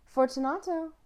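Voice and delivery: high, ringing call into the dark. Acted. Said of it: "I called again".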